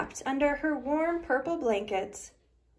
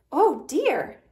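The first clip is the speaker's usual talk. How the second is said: a louder, duck-like oh dear; a light duck quack of dismay; 3 semitones higher, 7 dB louder than usual. Acted. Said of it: "quacked Sally".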